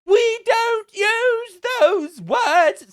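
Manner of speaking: very slow, high, loud prissy parody; mocking the Speaker. Vlogger parroting the Commons Speaker, hammed-up mockery. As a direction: stretch each word like a pompous chairman.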